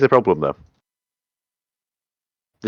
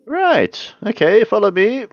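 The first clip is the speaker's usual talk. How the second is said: much higher, brisk guide voice; squeaky guide voice; 13 semitones higher, 3 dB louder than usual. Acted. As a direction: chirp it out like a little helper.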